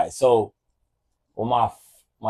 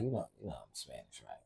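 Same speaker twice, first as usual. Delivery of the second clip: soft, low murmur to his daughter; quiet aside; 10 semitones lower, 22 dB quieter, faster than usual. Retold or imitated. Dad teasing his daughter, quiet low aside. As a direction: slip it in very softly and low. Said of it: "I'll always be like".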